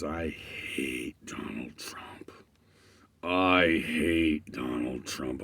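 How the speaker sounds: low slow whisper through gritted teeth; hissed whisper. Retold or imitated. Retelling a ranting guy, hissed through teeth. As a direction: hiss it through clenched teeth. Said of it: "grinding his teeth, kinda".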